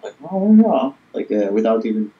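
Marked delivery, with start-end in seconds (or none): 0.3-0.9 high, loud posh English "Oh, yeah"; posh English accent demo for a friend. Shown as a demonstration. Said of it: "you just create the words out of your mouth".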